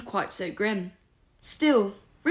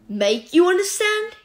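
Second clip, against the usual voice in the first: incredulous furious outburst, higher, louder and slow; raving; 10 semitones higher, 8 dB louder than usual. A narrator's reading. Remus raving at Sirius in the empty corridor, furious. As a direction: explode with rage. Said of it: "he raved".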